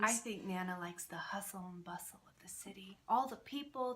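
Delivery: soft, breathy whisper aside. Real, not acted. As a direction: whisper it as if not to disturb the story. Host whispering during the reading.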